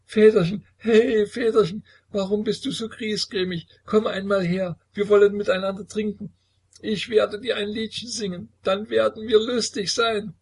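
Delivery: sehr hoch, laut, lallend kindisch; betrunken kichernd. A narrator's reading. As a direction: betrunkenes Kind, das den Vater ruft. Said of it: "kicherte trunken". (sehr hohe betrunkene Kinderstimme)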